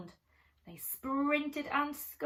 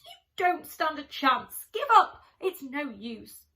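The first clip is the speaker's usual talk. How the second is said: loud, higher jeering shout; taunting shout; 4 semitones higher, 10 dB louder than usual. Acted. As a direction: shout him down so he gives up. Bruce continues the squirrel quarrel.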